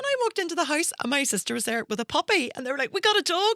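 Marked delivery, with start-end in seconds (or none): 2.9-3.5 excited. Retold or imitated. Sister announcing puppy.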